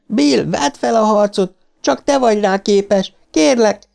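very high, desperate begging; screamed plea. A narrator's reading. Beverly begs Bill to fight, high desperate plea. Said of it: "sikoltotta Beverly".

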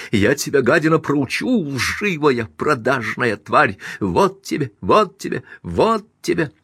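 high-pitched vengeful outburst; furious cursing. A narrator's reading. Wife beats her rival behind the door, furious cursing. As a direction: spit insults in time with the blows.

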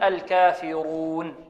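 slow "الكافرون" with a full, thick ر; heavy R. Shown as a demonstration. Teacher concluding the rule, slow heavy ر in "الكافرون". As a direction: draw out "الكافرون" with emphatic ر. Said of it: "الراء المضمومة تفخم".

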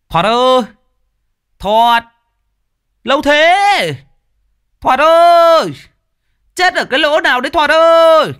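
put-on high drunken shouting at the door; loud calling. Drunk Hiệp yelling for Thoạt outside the locked gate, loud and high. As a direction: yell the name, pounding the gate. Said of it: "càng đập cửa mạnh hơn".

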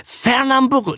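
high-pitched searching guess; tentative guess. Acted. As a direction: throw out a guess, voice way up. Guessing ship names from memory. (hoch und fragend geraten)

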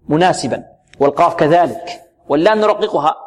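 normal talking voice

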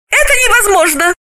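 very high, fast, clipped woman's voice; high indignant woman refusing; sharp refusal. Acted. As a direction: cut him off flatly in a high voice. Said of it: "Галина отрезала".

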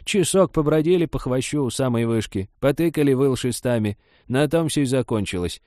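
high, dismissive granny voice; scornful old woman. Acted. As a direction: belittle their efforts. Old woman scornfully describes the search.

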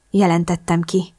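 usual speaking voice

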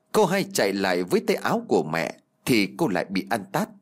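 usual speaking voice

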